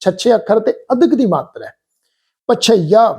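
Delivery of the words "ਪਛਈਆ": ਪਛਈਆ with doubled ਛ; correct pronunciation. Shown as a demonstration. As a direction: press the ਛ then open ਈਆ. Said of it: "ਇੱਥੇ ਪਾਠ ਉਚਾਰਨ ਕਰਾਂਗੇ ਪਛਈਆ".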